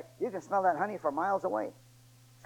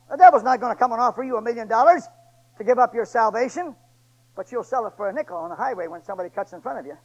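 ordinary talk

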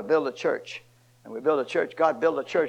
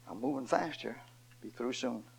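softer, lower aside; quiet aside; 4 semitones lower, 12 dB quieter than usual. Real, not acted. Preacher reassuring congregation he'll finish, quiet aside. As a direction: drop it under your breath.

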